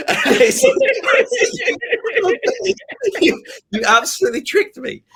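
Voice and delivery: high, loud laughing protest; laughing disbelief. Real, not acted. Reader laughing at how the author fooled him. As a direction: laugh through the admission of being fooled.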